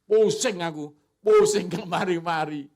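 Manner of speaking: loud grumbling about a headache; loud complaining. Pretend. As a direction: whine loudly about the dizziness. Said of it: "Daripada ngomong ini".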